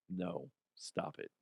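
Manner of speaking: hushed, breathy stop it; whispering. Real, not acted. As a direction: whisper it, half laughing. Whispered through laughter.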